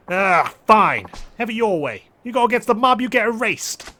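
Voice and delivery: loud, high-pitched mobster's threat; angry threat. Acted. Mobster losing patience with the fighter, loud threat. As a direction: raise your voice, done negotiating.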